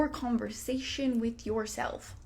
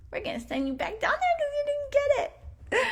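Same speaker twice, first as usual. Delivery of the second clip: much higher, otherworldly angel voice; the angels sending you back down, sing-song; angels' verdict. Retold or imitated. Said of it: "they're like".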